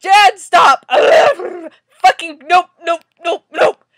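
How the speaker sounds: loud, frantic objection; exasperated yelling. Real, not acted. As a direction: shout each refusal in disbelief. Exasperated reaction to comic character.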